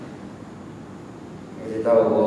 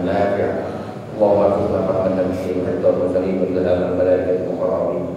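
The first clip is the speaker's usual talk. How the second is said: low, even-pitched Arabic supplication; prayer recitation; 5 semitones lower than usual. Real, not acted. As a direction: recite steadily on one low note. (membaca doa, nada rendah dan rata)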